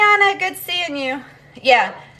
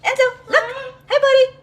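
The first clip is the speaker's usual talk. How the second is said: pet talk; 12 semitones higher than usual. Real, not acted. Getting bird to talk.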